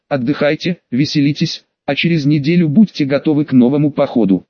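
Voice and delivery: usual speaking voice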